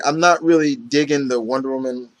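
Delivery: ordinary talk, nothing marked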